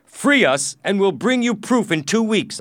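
high, loud plea; urgent bargaining. A narrator's reading. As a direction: call out an urgent offer. Captive bargains with slave dealer.

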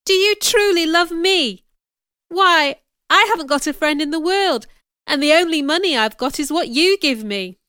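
high, loud, amazed outpouring; overwhelmed, disbelieving protest; crying out. A narrator's reading. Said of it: "I cried".

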